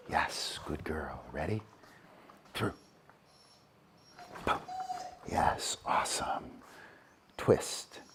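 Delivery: hushed praise. Real, not acted. Trainer whispering praise to her dog Cupcake, hushed. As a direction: breathe the cues softly so the dog stays focused.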